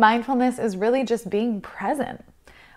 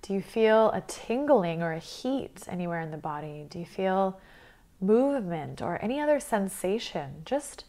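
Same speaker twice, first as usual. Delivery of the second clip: guided meditation; 6 dB quieter than usual. Acted. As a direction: guide them calmly through the breath, leaving space between phrases.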